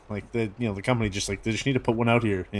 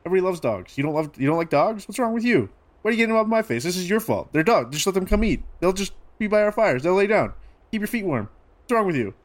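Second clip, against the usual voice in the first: defensive indignation; 8 semitones higher than usual. Acted. Vellum defends feeding wolves, confronted.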